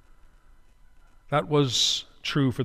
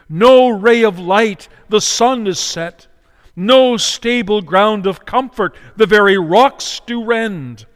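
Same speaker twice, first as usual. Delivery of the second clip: slow, loud, rising sermon cry; fiery preaching; 4 semitones higher, 8 dB louder, slower than usual. Acted. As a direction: preach each line slower and louder, like a mounting lament. Preaching at full force.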